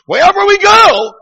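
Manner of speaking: sudden high, loud shout; preacher's outburst. Retold or imitated. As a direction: burst into a ringing pulpit cry. Preacher bursts out at climax.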